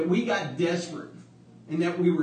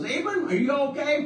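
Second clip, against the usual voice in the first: wife calling down the stairs, raised high; 7 semitones higher than usual. Retold or imitated. Wife calling down to the basement, high call. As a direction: call down worried to her husband.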